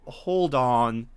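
normal talking voice

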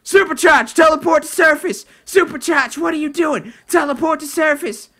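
high, loud mock viewer yells; hammed-up chat screaming; imitating screaming viewers. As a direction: play the fans shouting at the screen. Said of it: "you guys are probably screaming at me".

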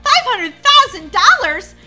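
very loud, high disbelief; shocked outburst. Acted. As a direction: blurt the figure back in shock.

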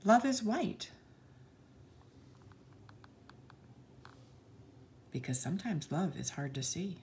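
put-on bright, sing-song read-aloud for children; lilting picture-book narration; storybook reading. A narrator's reading. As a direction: read the page like to a class of little kids.

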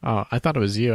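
normal talking voice

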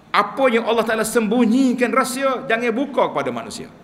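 emphatic preaching. Acted. Preacher stresses a warning.

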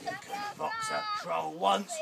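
usual speaking voice

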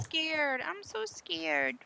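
high, soft, drawn-out whimper; mock fear. Player saying they are scared, mock whimpering. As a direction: whimper it as put-on fright.

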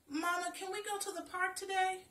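put-on high, loud eager child asking; a first grader begging to go; vibrant, anxious child. A narrator's reading. Girl begs her mama for the park, eager and high. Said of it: "asked a vibrant, anxious first grader".

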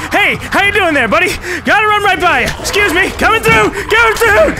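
high, loud frantic yelling; coming through shout. Real, not acted. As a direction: shove past them, shouting. Running past enemies.

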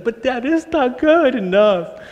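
put-on high, slow child's whine; whiny child. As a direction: whine it up high like a picky little boy. Child voiced in a story.

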